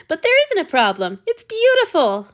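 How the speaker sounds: high, loud, slowing on beautiful; vehement Hanyu. A narrator's reading. Hanyu defending the painting, high and vehement. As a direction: dig in with fervour.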